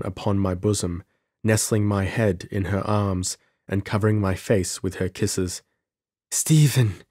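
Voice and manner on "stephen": higher-pitched tearful cry of a name; a touch of a sob, pitched up; sobbing, mildly acted. A narrator's reading. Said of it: "she sobbed over and over".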